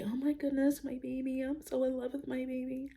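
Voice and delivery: high, soft gushing imitation of a new mother; mock-gushing new mom in love with her baby; excited new mom. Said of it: "She went from being so excited about her baby".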